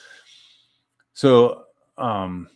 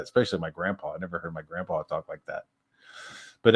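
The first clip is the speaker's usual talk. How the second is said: ordinary talk, nothing marked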